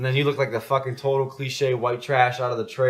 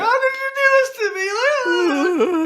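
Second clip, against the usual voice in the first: very high, loud, hammed-up wail of a crying girlfriend; mock wailing ex; 6 dB louder than usual. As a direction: shriek it like the ex bawling on the floor. Ex crying on her knees.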